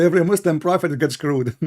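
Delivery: loud jeer breaking into laughter; gleeful, loud taunt; mocking laughter. Real, not acted. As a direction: crack up on your own punchline. Jeering punchline before a long laugh.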